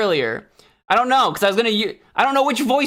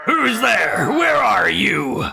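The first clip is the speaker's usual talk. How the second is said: shouting out; 3 semitones higher, 4 dB louder, much slower than usual. Acted. Calling out to hidden voice.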